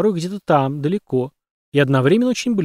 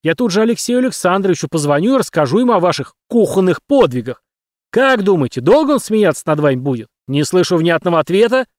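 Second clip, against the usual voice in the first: loud, higher angry tirade; angry yelling; 6 semitones higher, 5 dB louder than usual. A narrator's reading. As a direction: keep roaring at them. Captain keeps yelling at cadets.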